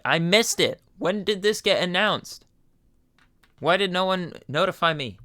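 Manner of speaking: mock-outraged fan; dismayed outburst. Real, not acted. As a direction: protest missing it.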